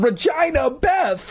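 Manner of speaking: very high-pitched mock shout. A narrator's reading. Two boys shouting together to the girls, high shout. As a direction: call the names out at the top of the register. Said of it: "Todd and Danny shouted together".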